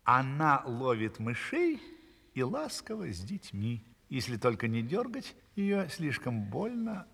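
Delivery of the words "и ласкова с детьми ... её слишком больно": usual speaking voice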